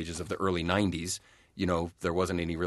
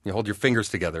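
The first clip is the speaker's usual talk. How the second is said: ordinary talk, nothing marked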